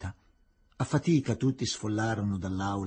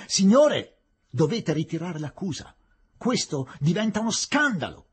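high, loud cry of outrage; sudden yell; 6 semitones higher, 6 dB louder than usual. A narrator's reading. Nawab demands the charge be withdrawn, yelling suddenly. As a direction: yell it from the back of the room. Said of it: "urlò improvvisamente il Nawab Bahadur".

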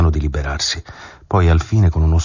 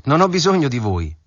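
high, raised voice through the door; shout; 9 semitones higher than usual. A narrator's reading. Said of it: "ella gridò". (voce alta e acuta)

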